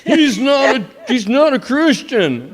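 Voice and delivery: high, loud, drawn-out denouncing; hammed-up cry of accusation; mock accusation. Acting out Christians chasing, accusing him.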